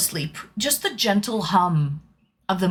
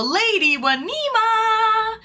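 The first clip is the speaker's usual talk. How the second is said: drawn-out high greeting; 12 semitones higher, much slower than usual. Real, not acted. Stretched greeting to chatter.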